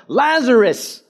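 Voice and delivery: high, loud call of one name; shouted name. Acted. Jesus calling one corpse by name, shouting. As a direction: call only him out. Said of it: "used Lazarus' name".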